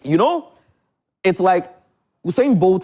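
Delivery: high, loud windup to a comparison; excited build-up. Real, not acted. Guest winding up a comparison for the host, excited and loud. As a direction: pitch up to sell the point.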